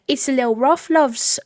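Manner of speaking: high, loud hammed-up British accent. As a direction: belt it out in an exaggerated British lilt. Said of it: "haven't done the British accent".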